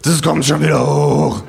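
laut und hoch hinterhergrölen; Grölen. Acted. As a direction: betrunken in die Kneipe grölen. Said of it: "gröhl ich". (lautes, hohes Grölen)

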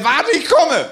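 high mock holler; high-pitched call to a stranded friend. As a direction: shout it over as the comic rescuer. Friend calls over to the rescue.

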